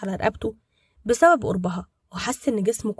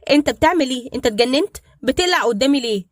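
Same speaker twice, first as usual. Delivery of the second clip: flustered and quick; 4 semitones higher, 6 dB louder than usual. Acted. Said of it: "فاتوترت وقالت بسرعة".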